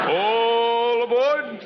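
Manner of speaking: long, drawn-out "All aboard" like a conductor; hammed-up railroad conductor calling passengers; train conductor call. Host calls out train departure.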